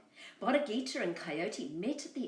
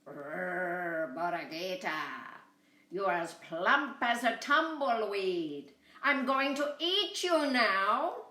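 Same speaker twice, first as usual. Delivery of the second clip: slow, louder growling coyote; 5 dB louder, slower than usual. Acted. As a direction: growl it out, building to the threat. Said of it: "He growled".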